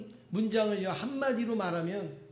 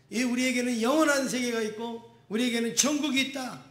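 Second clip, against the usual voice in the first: fervent preaching; 4 semitones higher, 3 dB louder than usual. Acted. Pastor preaching at full force.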